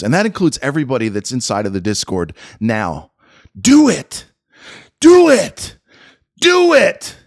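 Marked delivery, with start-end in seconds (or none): 3.6-7.2 loud, high repeated do it; mocking shout. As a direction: bark do it at the CEO. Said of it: "let me repeat it".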